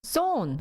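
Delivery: "Sown" said high with a falling tune; high, falling "Sown" after hearing it; checking pronunciation. Shown as a demonstration. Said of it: "How do you pronounce it?".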